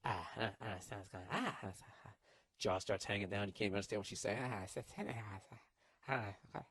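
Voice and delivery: soft, slack-jawed stammering; dumbfounded stammer. Retold or imitated. Boyfriend stunned speechless, mumbling and stammering. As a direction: gape and stammer, lost for words.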